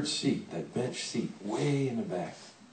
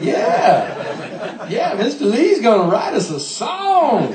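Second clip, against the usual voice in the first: put-on excited boys; 9 semitones higher, 8 dB louder, slower than usual. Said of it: "started going like".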